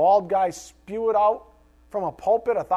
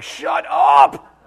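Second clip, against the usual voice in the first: loud, high, snapping yell; imitating the family yelling shut up; mock family shout; 9 semitones higher, 10 dB louder than usual. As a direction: bark it like an annoyed family. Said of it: "My family was like".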